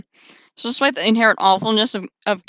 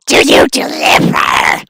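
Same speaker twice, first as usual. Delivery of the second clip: slow rasping monster whisper; creepy monster caller; 4 semitones higher, 5 dB louder, slower than usual. Acted. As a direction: breathe it like a lurking creature. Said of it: "batshit insane, creepy voice".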